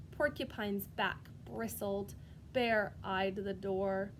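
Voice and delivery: ordinary delivery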